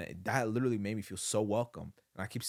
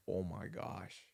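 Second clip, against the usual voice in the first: disbelief; 2 semitones lower, 6 dB quieter, slower than usual. Retold or imitated. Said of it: "in disbelief".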